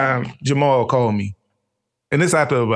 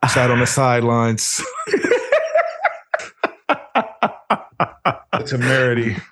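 laughing. Real, not acted. Laughing over the wedding snub.

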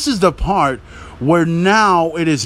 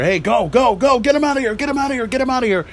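much higher, pressing shout to the driver; urgent yelling official; 8 semitones higher, faster than usual. Retold or imitated. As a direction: hurry the driver off, half-shouted.

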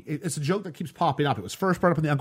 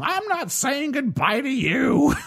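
mock drunk Mary; 10 semitones higher, slower than usual. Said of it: "Someone's going".

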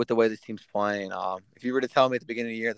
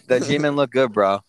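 normal talking voice

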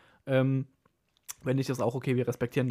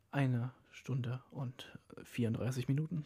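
geflüstert; 9 dB quieter, slower than usual. Real, not acted.

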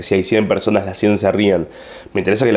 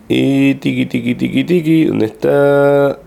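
higher, slow sing-song hunt for the phone; playful search chant, higher and slowed; playful searching singsong; 4 semitones higher, slower than usual. Real, not acted. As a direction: call out tiki tiki in a playful lilt.

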